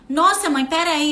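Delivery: lightly voiced son begging for time; imitating son. Son interrupted at videogame asks mother to wait, mildly whiny. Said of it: "Aí ele fala assim".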